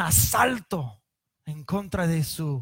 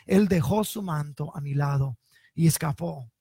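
measured Bible reading voice; scripture reading; 4 dB louder than usual. Acted. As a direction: read the passage like a preacher at the pulpit. Reads Genesis passage aloud.

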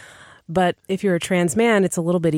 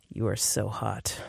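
deep terse man; 7 semitones lower, 9 dB quieter than usual. Shown as a demonstration. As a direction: growl it low like a romance hero. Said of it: "a man of few words".

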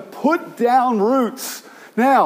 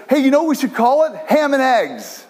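higher-pitched, quick eager pitch; excited chicken; 5 semitones higher than usual. Acted. Chicken pitching a restaurant name to its friend, excited. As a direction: blurt the idea out, swooping up.

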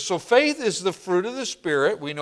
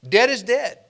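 "Dead is dead" raised high and loud, both "dead" hammered; emphasis on dead; 7 semitones higher, 5 dB louder than usual. Shown as a demonstration. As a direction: punch each "dead" with high, loud force. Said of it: "Now, I'm emphasizing something".

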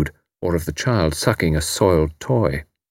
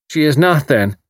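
Irish landlady; 6 semitones higher than usual. A narrator's reading. Mrs O'Rourke voiced.

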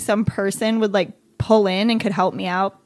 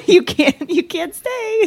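breaking into laughter, voice going much higher and slower; can't get the words out for laughing; laughing through it; 10 semitones higher, 6 dB louder, slower than usual. Real, not acted. Retelling what she told her son who smelled of vomit: laughing through the line.

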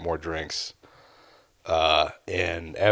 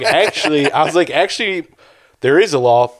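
higher, louder, half-laughing gotcha; mock heated comeback; 8 semitones higher, 7 dB louder than usual. Storyteller recalling telling off a drunk, loud and high. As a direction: snap back with glee. Narrator confronts drunk guy.

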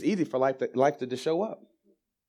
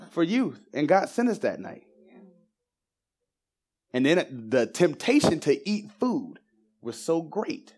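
raised, ringing preacher's voice; preaching at full force; 7 semitones higher, 4 dB louder than usual. Acted. Preacher tells the church about the boy at Cookout, preaching hard and high. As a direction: lift it high and drive it home to the congregation.

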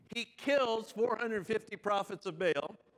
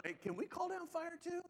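high, soft voice asking a favour; eager disciples; 9 semitones higher, 8 dB quieter than usual. Acted. Disciples asking Jesus to call down fire, small high pleading voice. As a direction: lightly mimic disciples piping up in a small high voice.

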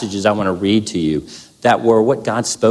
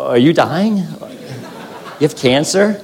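higher, laughing mock wife's worry; alarmed wife; 6 semitones higher, much slower than usual. Wife sees her crying husband, higher mock alarm. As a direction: play the wife's alarm while half laughing.

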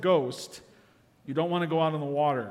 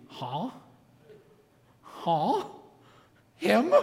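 baffled surprise; 3 semitones higher, much slower than usual. Retold or imitated. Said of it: "your correct response should be".

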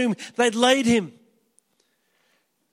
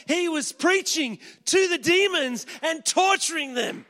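full-force preaching; 6 semitones higher than usual. Acted. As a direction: preach it like thunder, building.

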